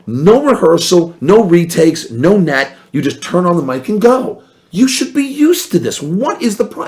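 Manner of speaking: high-pitched mock exasperation; exasperated disbelief. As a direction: rib him, amazed he can't. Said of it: "I'm like".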